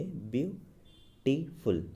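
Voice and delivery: Beautiful broken into three beats, view, टी, फुल; split into syllables. Shown as a demonstration. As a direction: pause between each syllable of beautiful. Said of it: "इस word को अगर हम बोलेंगे". (Beautiful को तोड़कर बोलें, ब्यू... टी... फुल)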